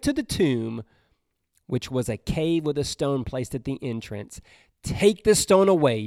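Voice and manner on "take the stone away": command. Acted. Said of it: "Jesus ordered".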